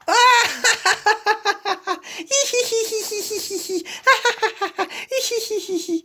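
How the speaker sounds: high, rolling hee-hee-hee laugh; long giggling fit. Acted. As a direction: laugh until you run out of breath. Long laughing fit over banana.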